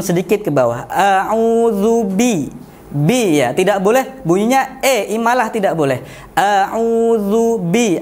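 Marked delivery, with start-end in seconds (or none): 0.9-2.5 bi with a clean i; clear kasrah. Shown as a demonstration. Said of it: "bibir bawah turun". 6.3-8.0 slow a'udzu bi, every vowel clear; careful crisp a'udzu bi; clear articulation. Shown as a demonstration. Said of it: "artikulasinya harus jelas".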